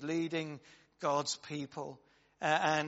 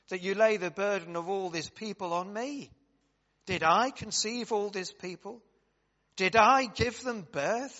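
impassioned scripture reading; 2 semitones higher, 4 dB louder than usual. Acted. Reads Moses' complaint aloud.

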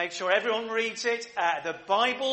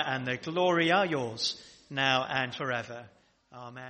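prayer; 5 semitones lower than usual. Real, not acted. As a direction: recite the Lord's Prayer together, unhurried. Leads the Lord's Prayer.

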